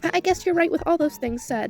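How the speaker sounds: ordinary talk, nothing marked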